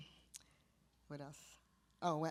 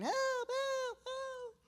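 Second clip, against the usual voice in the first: high hammed-up cries for help; mock stormtrooper cries; much slower than usual. Stormtroopers being clubbed by Ewoks, high mock cries. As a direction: squeal it like a beaten soldier. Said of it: "the guys were going".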